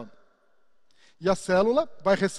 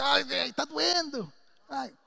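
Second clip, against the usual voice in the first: high, pained whimper of complaint; lightly hammed-up hurting voice; mock pained whine; 5 semitones higher, slower than usual. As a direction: whine that it hurts. Pained reply in acted dialogue.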